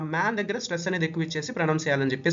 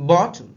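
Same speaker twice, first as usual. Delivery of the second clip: Bottle with బా hit louder; stress on first syllable; 5 dB louder than usual. Shown as a demonstration. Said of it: "బీ మీద stress అండి".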